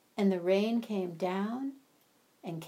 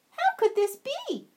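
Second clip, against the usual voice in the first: high, louder wondering voice; amazed ant; 13 semitones higher, 5 dB louder than usual. Acted. Ant marvels to his neighbors, high and amazed. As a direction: puzzle over it in disbelief.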